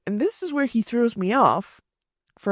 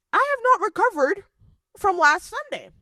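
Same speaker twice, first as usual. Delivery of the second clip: high, louder exasperation; exasperated refusal; 12 semitones higher, 3 dB louder than usual. Retold or imitated. Telling the date, high and exasperated. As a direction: push him away, still offended.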